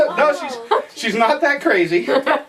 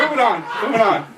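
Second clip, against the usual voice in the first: usual speaking voice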